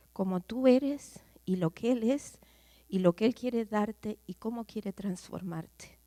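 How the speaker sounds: usual speaking voice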